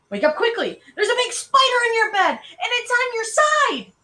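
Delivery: high, loud, urgent alarm; shrieked warning, climbing very high; scary, urgent. A narrator's reading. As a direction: frighten a sleeping sister awake with a pretend warning. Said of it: "making a scary, urgent voice".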